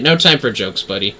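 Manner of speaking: ordinary delivery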